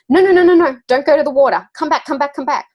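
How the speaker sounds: put-on high, loud urgent call to a child; snatch the child back from the water with your voice; alarmed calling out. Mum calls her little girl back from the sea, loud and alarmed.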